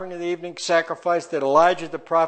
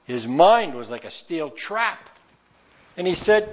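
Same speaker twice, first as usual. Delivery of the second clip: ordinary delivery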